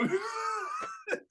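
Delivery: high-pitched helpless laugh. Real, not acted. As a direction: squeal with laughter at the memory. Laughing at the cruel memory.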